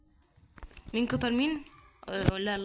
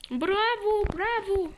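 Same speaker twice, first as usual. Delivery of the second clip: high praise; 9 semitones higher, slower than usual. Real, not acted. Praising teammate in game.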